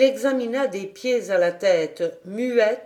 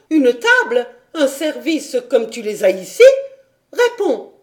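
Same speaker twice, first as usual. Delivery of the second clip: higher, louder haughty scolding; stern lecture; 5 semitones higher, 5 dB louder than usual. A narrator's reading. Governess berating the girl as ungrateful, haughty and loud. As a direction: lecture the ungrateful child sharply.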